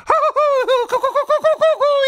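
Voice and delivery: very high, long bird cackle; kookaburra cackle. Acted. Cookie cackles at the hungry tiger.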